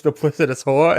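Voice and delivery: ordinary delivery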